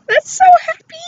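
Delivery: high, loud burst of delight; gleeful squeal. Real, not acted. Gushing over hat, laughing.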